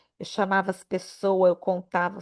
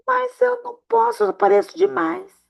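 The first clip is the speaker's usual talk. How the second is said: squeaky mock humility; mock modesty; 13 semitones higher than usual. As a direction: ham up a fake humble complaint. Mocking self-importance.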